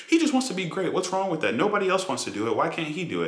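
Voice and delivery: high, whiny mock defence; mocking fan voice. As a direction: whine it like a fan defending Timothée. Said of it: "they're like".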